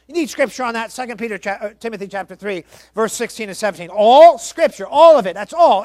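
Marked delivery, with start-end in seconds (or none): 3.9-5.8 all hammered, much louder; preaching shout. Real, not acted. Preacher hammers all to the congregation, much louder. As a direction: hammer the word all home.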